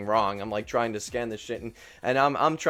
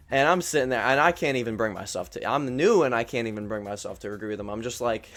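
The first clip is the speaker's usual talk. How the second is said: ordinary delivery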